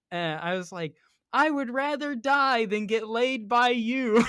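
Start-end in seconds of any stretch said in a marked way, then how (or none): 1.3-4.2 high, slow, loud holler; drawn-out shouted comeback; retold yell. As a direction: yell it back across a crowd. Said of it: "I yelled back to this lady".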